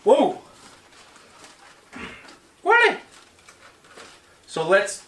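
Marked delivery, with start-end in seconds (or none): 0.1-0.4 sudden high surprised whoa; startled, loud and high. Real, not acted. Surprised exclamation. 2.6-3.0 shrill, very high disbelieving really; surprised. Real, not acted. Surprised question.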